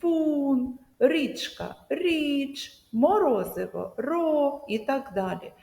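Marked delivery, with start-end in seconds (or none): 0.0-0.8 «вун» held long and pitched high; stressed syllable «вун». Shown as a demonstration. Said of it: "вимовляємо з більшою силою голосу". 1.9-2.8 «річ» alone, held on a higher pitch; stressed syllable «річ». Shown as a demonstration. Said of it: "вимовляємо з більшою силою голосу". 4.0-4.6 «ро» alone, high and held; stressed syllable «ро». Shown as a demonstration. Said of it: "вимовляємо з більшою силою голосу".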